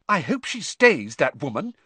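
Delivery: lightly voiced schoolgirl; girl praising the new teacher; Parvati voice.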